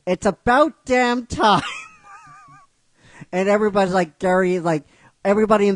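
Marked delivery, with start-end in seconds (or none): 0.1-1.6 slow, high, loud shout of relief; drawn-out fed-up yell; exasperated shout. Retold or imitated. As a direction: bellow it like it's overdue. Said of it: "He's like".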